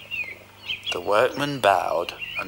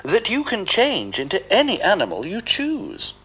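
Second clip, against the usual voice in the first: much higher, bright cat voice; Puss the cat; 10 semitones higher than usual. Acted. Puss baiting the ogre, high coaxing voice. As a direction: coax the ogre along.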